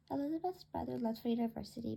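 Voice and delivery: usual speaking voice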